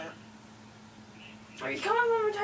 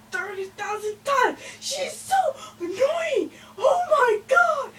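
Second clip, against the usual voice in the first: high, loud whining cry; whiny shriek; 11 semitones higher, 4 dB louder than usual. Acted. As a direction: shriek it like a spoiled kid. Complaining about being eaten.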